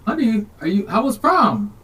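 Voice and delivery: high, eager dad voice; imitating a chirpy, unsuspecting father; doting dad. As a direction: pitch up like a dad asking his daughter. Dad greeting daughter in retold scene.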